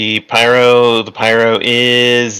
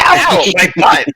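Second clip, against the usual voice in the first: high laughing cry of pain; laughing yelp; 9 semitones higher than usual. Acted. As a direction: squeal it through laughter. GM voices bitten monster.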